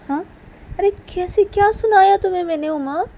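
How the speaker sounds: high, loud disbelieving question; acted indignant denial; protesting innocence. Sanju denies the accusation.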